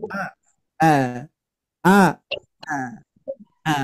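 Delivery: each "A" started with a glottal catch and cut short; throat-stopped A. Shown as a demonstration. Teacher drilling the hamzah A for parents. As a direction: repeat "A" with a hard stop in the throat each time. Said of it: "Cara pengucapan A-nya itu beda".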